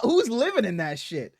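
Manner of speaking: ordinary delivery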